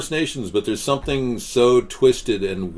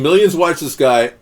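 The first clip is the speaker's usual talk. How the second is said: higher, louder mocking quote; quoted mocking commenter; 6 semitones higher, 8 dB louder than usual. Host reading out a viewer's scoff, loud and mocking. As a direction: pitch it up and make fun. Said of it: "the guy who was saying".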